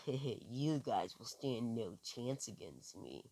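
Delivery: a quiet, gloating boast with a grin; devilish grin. Acted. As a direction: slowly savor the threat through a sly grin. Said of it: "as he says with this devilish grin on his face".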